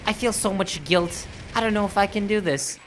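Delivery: high, whiny mob wife; Carmella voice. Acted. Mob wife pleads with the priest, whining high. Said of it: "Well, Carmella?".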